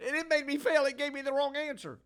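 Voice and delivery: high, loud hammed-up complaint; Nancy Pelosi impression. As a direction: whine like a wronged public figure. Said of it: "There's your Nancy Pelosi impression".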